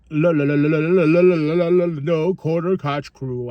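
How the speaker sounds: real stammering repeats; stuck on the word, stutter it out; stumbling over a name; tongue-tied stammer. Real, not acted. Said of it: "I meant to say".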